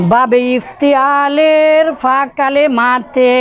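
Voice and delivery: বাবে ইফতিয়ালের ফা কালেমাতে read slowly for students to repeat; slow recitation lead. Shown as a demonstration. Teacher leads students in a last slow reading. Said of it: "শেষবারের মতো একটু পড়ে নেন".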